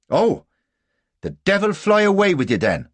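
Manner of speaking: loud, high-pitched outburst; sudden anger. A narrator's reading. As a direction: flare up and curse him. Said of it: "suddenly angry".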